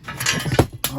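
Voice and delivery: breathless and panting; out of breath. Real, not acted. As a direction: talk between quick breaths. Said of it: "I'm out of breath".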